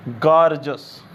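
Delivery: गॉर्जस as gor-jus, two syllables; correct pronunciation. Shown as a demonstration. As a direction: say गॉर्जस, never gor-jee-us. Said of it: "गॉर्जियस नहीं पढ़ोगे". (गॉर्जस दो हिस्सों में, गॉर-जस)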